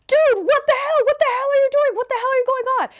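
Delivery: high-pitched freaked-out mock shout; imitated yelling. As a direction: yell like a horrified neighbor. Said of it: "the neighbor is yelling at him".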